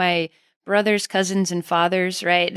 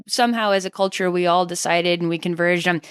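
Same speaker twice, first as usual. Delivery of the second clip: usual speaking voice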